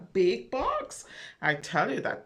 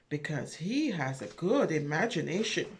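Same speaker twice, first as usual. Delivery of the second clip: ordinary delivery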